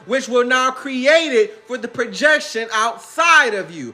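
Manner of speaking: preachy guru sermon. Acted. Future self preaching divine wisdom, slow and solemn. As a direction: lay out the revelation slowly.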